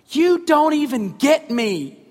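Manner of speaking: put-on hurt child pouting at her dad; upset five-year-old. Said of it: "she just looks at me and she goes".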